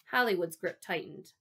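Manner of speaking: ordinary delivery, nothing marked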